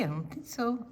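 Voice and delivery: usual speaking voice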